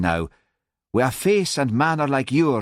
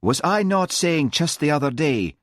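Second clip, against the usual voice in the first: higher-pitched, mildly dreamy musing; 7 semitones higher than usual. A narrator's reading. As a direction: muse aloud with a touch of dreaminess. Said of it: "said Hamish dreamily".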